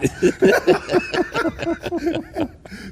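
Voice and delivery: high, drawn-out laughter; long laugh. Real, not acted. Guest laughing at his own story, long and high. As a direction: keep laughing as the story lands.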